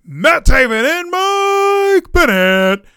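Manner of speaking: high, loud, drawn-out announcer call; big hyped-up commentator imitation; excited commentator. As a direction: belt out the names like a ring commentator. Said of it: "The commentator even goes".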